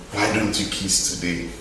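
low, soft "why don't you kiss today"; low challenge. Acted. Preacher putting the final question low and soft to the church. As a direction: drop down and ask it quietly.

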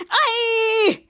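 high, loud mock scream; put-on yelp of fright; acted scared shriek. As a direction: shriek as if spotting a crocodile. Schwartz scared by the crocodile.

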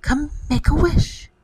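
high storybook fairy voice; high-pitched fairy godmother summons; fairy's cry. Acted. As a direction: invite in a high, lilting call. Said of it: "she cried".

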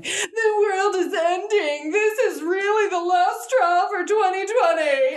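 high, drawn-out fake crying; hammed-up tearful wail, an octave up; mock wailing. Mocking social media posts about a celebrity death, mock wailing. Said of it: "you see all the posts on".

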